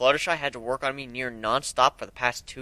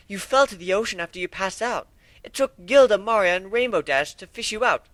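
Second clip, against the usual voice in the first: higher, prim and composed; Rarity voiced; 8 semitones higher than usual. Rarity tells the hero how badly he was hurt, higher and composed. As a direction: report the injuries like a proper lady. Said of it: "Rarity stated".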